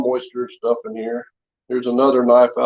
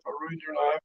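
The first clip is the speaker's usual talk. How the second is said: repeated much softer, trailing away; fading tearful repeat; 3 semitones higher, 14 dB quieter than usual. Retold or imitated. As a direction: let the apology die to almost nothing. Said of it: "She was in the house crying".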